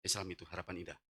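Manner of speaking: low, soft, halting words; weak sick voice. Retold or imitated. Sick husband asks wife for hospital, weak low voice. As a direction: talk with little breath left. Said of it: "Saya ngomong gini".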